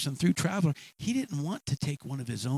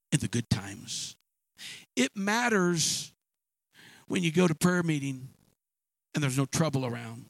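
preaching; 4 semitones higher than usual. Real, not acted. Preacher urging the congregation, emphatic and building. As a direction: build like a preacher driving home a point.

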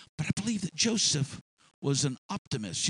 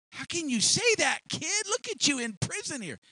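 higher, incredulous jeer; much higher, mocking voice; scoffing prisoner; 10 semitones higher than usual. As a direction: scoff in disbelief.

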